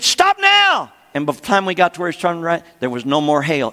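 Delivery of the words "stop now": very high, loud order to stop; commanding shout. Retold or imitated. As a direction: shout it at the storm. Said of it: "And she said".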